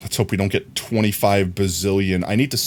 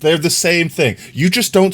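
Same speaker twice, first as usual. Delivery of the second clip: excited; 10 semitones higher, 6 dB louder, slower than usual. Real, not acted. Excited defence of the word.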